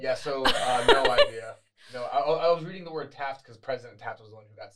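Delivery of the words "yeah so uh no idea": laughing through the words; laughing admission. Real, not acted. Co-host caught mixing up presidents, laughing. Admits not knowing, laughing.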